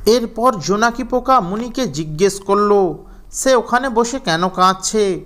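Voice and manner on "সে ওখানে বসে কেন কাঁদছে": usual speaking voice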